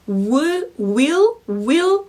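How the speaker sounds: "will" with lips pursed on the w, rising at the end; rounded-lip will. Shown as a demonstration. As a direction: model "will" in separate, rising repetitions. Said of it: "will 하고 입술을 모은 상태에서".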